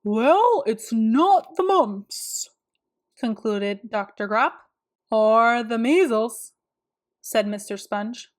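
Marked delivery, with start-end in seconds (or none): 0.0-2.5 pompous specialist. A narrator's reading. Specialist's verdict. 5.1-6.5 specialist. A narrator's reading. Second specialist speaks.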